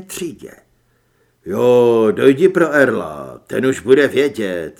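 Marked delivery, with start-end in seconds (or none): 1.5-4.7 deep, loud agreement; lower, much louder man's voice; double's character voice. Acted. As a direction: play the double, low and forceful.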